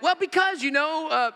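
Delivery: high-pitched mock stammered reply; flustered excuse. Preacher imagining Balaam answering his donkey, mock flustered.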